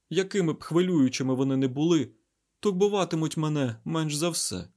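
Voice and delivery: vexed scientist, high and loud; Айзек. Acted. Scientist worried for his stricken friend, vexed rant.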